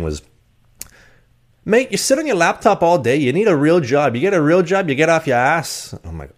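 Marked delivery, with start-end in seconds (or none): 1.7-5.9 loud, high mock Irish scolding; Irish accent. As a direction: imitate a nagging Irish roommate at full pitch. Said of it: "work on your Irish accent".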